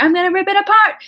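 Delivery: high, loud monster snarl of rage; furious creature threat. Acted. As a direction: roar it out like an enraged monster. Creature vows to destroy it.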